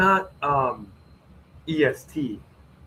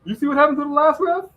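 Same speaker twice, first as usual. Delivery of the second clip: high, pleading scared ref voice; a ref who wants no part of it; 12 semitones higher, 4 dB louder than usual. Retold or imitated. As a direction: play a ref refusing to go out, voice up high. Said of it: "the refs were like".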